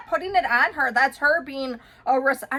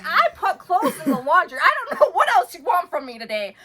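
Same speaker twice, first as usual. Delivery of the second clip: higher, laughing exasperated retort; half-laughing complaint; laughing exasperation; 5 semitones higher, 4 dB louder than usual. Retold or imitated. Wife retorting to husband about laundry, laughing. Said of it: "Seriously, I was like".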